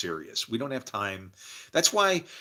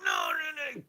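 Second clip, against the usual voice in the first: mock refusal; 4 dB quieter than usual. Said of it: "acting out a resisting listener".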